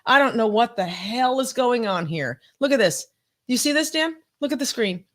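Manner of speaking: ordinary talk, nothing marked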